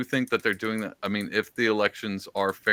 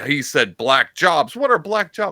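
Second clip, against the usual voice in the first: mocking critics; 4 semitones higher, 7 dB louder, slower than usual. Mocking what everyone was saying.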